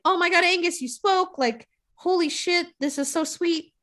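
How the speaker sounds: high-pitched, excited gushing all through; freaking out. Retold or imitated. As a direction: squeal it like the whole crowd is amazed. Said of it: "Everyone's freaking out".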